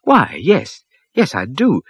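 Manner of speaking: man answering the prophet, suddenly hopeful; brightening hope. A narrator's reading. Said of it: "The man's face brightened".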